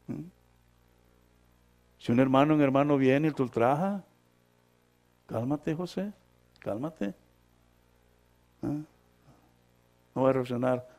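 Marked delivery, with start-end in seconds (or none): 5.3-7.1 soft, a touch of a soothing inner voice; inner voice calming. Acted. Inner voice calming José, soft and gentle. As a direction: hush José like a gentle voice within. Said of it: "ya hay alguien adentro que te dice".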